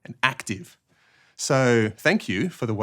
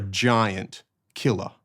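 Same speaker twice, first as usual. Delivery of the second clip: "giant", a beat, then "killer"; pause between GIANT and KILLER; slower than usual. Shown as a demonstration. Teacher explaining the very tall killer, giant and killer separated. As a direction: set "giant" apart from "killer" as a describing word. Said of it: "This is a killer who is very tall".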